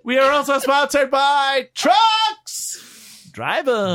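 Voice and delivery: slow, loud announcer shout. Acted. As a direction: bellow the sponsor like a stadium announcer. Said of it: "[name] audition for being a, a, an announcer".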